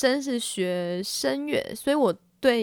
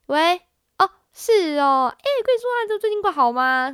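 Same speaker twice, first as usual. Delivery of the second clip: chirpy high-pitched phone greeting; bubbly casual hello on the phone; high lively phone voice; 9 semitones higher, 4 dB louder than usual. Shown as a demonstration. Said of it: "这种声音". (活泼高音的接电话声音)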